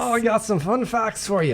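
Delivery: high Scottish talking head voice; Mimir voice. Acted. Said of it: "Mimir, would you like to say fun facts?".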